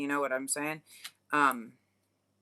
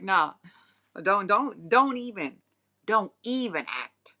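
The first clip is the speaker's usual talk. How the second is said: scornful dismissal; 4 semitones higher, 6 dB louder, slower than usual. Retold or imitated. Dismissing the fakers.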